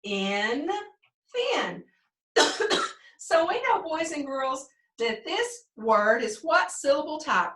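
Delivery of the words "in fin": teacher blending "thin" with a short vowel; blended short vowel. Shown as a demonstration. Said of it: "Do it again".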